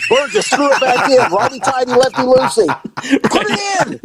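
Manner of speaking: high, panicked instructions; frantic orders. Retold or imitated. Officer giving his rookie panicked orders, high. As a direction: bark nonsense orders in a panic. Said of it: "I'm like".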